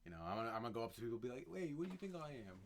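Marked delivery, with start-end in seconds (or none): 1.5-2.6 higher-pitched playful put-on voice; lightly voiced mock stranger's question; random put-on voice. Traveler testing silly voices on strangers in London, lightly put-on voice. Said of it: "just put on random voices".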